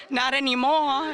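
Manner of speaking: high, loud, slow deadpan caricature; mock lady. Lady answers after beating the dog, high and loud. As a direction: drag it out flatly.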